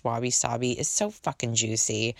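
ordinary talk

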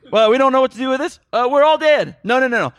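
high, loud, flustered mock voice of the writers; mocking clueless writers. Podcaster mocks the writers of the movie's ending, high and loud. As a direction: ham it up as panicking screenwriters.

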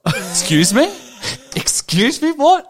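can't believe what he just heard; squeaky shocked what; incredulous excuse me. Retold or imitated. Incredulous at scammer's Coca-Cola line.

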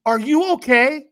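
slow, high worried check-in; concerned stranger. Retold or imitated. Stranger asking a nervous man if he's okay. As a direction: ask gently if he's all right, drawn out.

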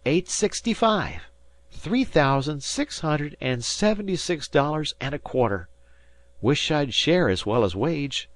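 put-on high-pitched boy reporting the tally; young Harvey. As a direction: call out figures like a keen lad. Narrator voices young Harvey.

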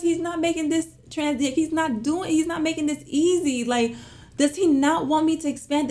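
high, wailing re-enactment of crying; imitated crying. Retelling her meltdown to her husband, mock-tearful wail. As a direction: whine it tearfully, half laughing at yourself. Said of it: "I'm in the house and I'm crying".

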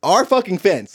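ordinary delivery

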